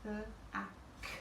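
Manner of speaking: B, B, A, K said softly under the breath; soft spelling while writing; quiet spelling. Shown as a demonstration. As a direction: murmur the letters as you write. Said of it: "B-L-A-C-K".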